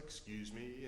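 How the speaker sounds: very soft, low aside with a lift at the end; barely voiced, courteous interruption; polite excuse me. Man pausing his story at the bus stop, soft polite aside. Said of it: "he's like".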